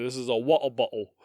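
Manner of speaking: mock English accent, dropped R's. Shown as a demonstration. Demoing an English accent to cohost, mock English. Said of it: "my English accent".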